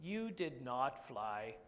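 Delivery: low, slow and soft; slow low elephant voice. Acted. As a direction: break the news gently as a big elephant.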